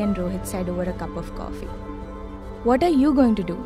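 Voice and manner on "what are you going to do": ordinary delivery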